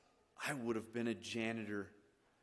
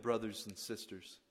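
ordinary delivery

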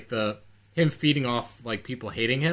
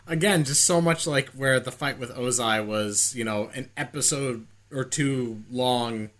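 ordinary talk

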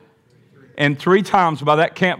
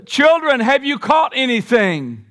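calling out; 7 semitones higher than usual. Acted. Jesus calling from shore.

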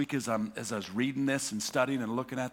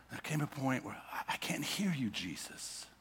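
lost and quiet; 3 semitones lower, 12 dB quieter than usual. Real, not acted. Feeling abandoned by Jesus.

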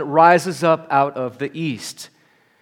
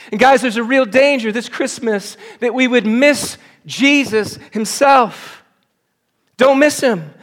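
loud, high, urgent preaching; preaching at full force; 7 semitones higher, 5 dB louder than usual. Acted. Pastor urges the church not to miss Jesus, preaching hard. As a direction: press the call on every listener.

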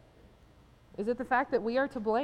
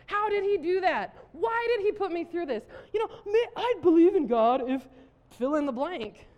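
mock grievance; 6 semitones higher, 5 dB louder than usual. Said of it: "many of us are mad at God".

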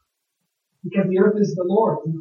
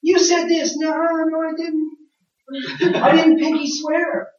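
put-on much higher childish squabble; playground kids; 8 semitones higher, slower than usual. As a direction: play a kid insisting, then denying. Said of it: "what we used to do when I was a kid".

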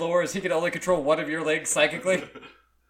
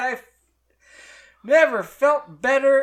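higher, slow and louder, a mock Joker calm; creepy calm; 8 semitones higher, 8 dB louder than usual. Reading the post aloud, the tattooed man answers the artist in a hammed-up creepy voice. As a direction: play it like an unhinged villain, slow and raised. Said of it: "laughing and calmly said".

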